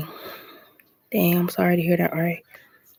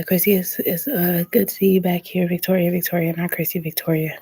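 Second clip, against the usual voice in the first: usual speaking voice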